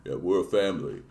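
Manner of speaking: low, earnest action-hero murmur; Vin Diesel impression. Streamer parodying Vin Diesel's family speech, low and soft. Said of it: "Vin Diesel".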